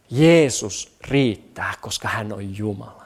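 low, reverent hush; hushed conviction. Real, not acted. Hushed conclusion after awed pause.

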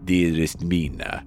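low, solemn hammy vampire; Dracula. Pretend. Vampire telling dearest Mina his origins, low and solemn. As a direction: woo her with grave accented melodrama. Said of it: "I'll be Dracula coming in".

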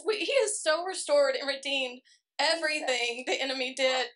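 usual speaking voice

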